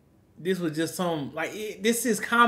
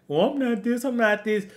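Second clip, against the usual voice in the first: mock protest; 5 semitones higher than usual. Mocking the accused man's excuses.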